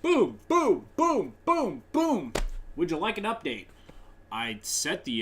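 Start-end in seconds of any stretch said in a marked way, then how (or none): 0.0-2.3 high, loud repeated booms, each swooping down; punchy falling "boom" sound effect; imitated computer noise. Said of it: "my computer decides to". 2.8-3.7 lightly voiced pushy computer pop-up; a hint of a chirpy automated prompt; computer's prompt. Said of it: "my computer decides to".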